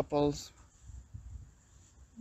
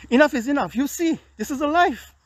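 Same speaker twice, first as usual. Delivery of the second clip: higher and pushed, fed up; exasperated outburst; 7 semitones higher than usual. Real, not acted. Man fed up with his life here declares his limit, exasperated. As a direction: say it like you've finally snapped.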